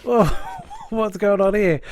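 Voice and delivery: mock-startled recoil from a baby; startled whoa. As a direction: flinch back and blurt it out in alarm. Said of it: "Royal flinches and backs up and goes".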